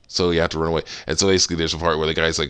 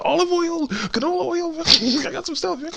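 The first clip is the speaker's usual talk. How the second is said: eager, very high falsetto patter; coaxing walrus cook. Retold or imitated. Walrus tempting Crash with bath oils, eager falsetto. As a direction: pile on the offers in a squeaky comic voice. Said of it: "doing the same thing with this walrus".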